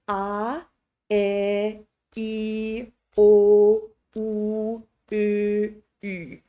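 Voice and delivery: a, e, o, u held as single steady sounds; separate, clean vowels with no glide; crisp pure vowels. Shown as a demonstration. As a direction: hold each of a, e, o, u pure. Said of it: "just keep that really crisp".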